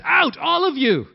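loud, high order to clear the room; commanding shout. Acted. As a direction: bark it out like a ruler sending everyone away. Joseph orders everyone out.

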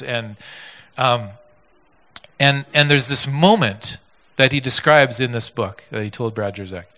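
ordinary talk

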